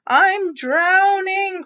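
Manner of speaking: high, loud, slow panicked wail; Froggy panicking in the pond; crying out. Acted. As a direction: wail it like he's sinking. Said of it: "cried Froggy".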